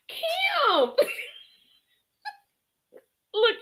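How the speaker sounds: high, laughing shriek of the name. Real, not acted. Host shrieking with laughter at Kim's claimed age.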